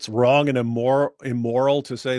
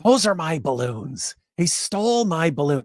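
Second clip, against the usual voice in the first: Sajid whisper voice; 4 semitones higher than usual. Retold or imitated. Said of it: "do it like Sajid".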